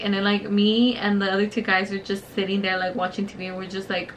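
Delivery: ordinary talk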